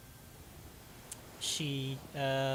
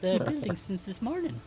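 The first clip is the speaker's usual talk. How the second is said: high, raised halfling voice; halfling woman; 3 semitones higher, 8 dB louder, faster than usual. Acted. Halfling answers the travelers' question, high loud voice. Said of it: "she says".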